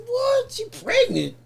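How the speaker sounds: high, squeaked astonishment; shocked disbelief. Retold or imitated. Defendant learning the pregnancy news in court, high shocked disbelief. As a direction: retell it as a stunned double take.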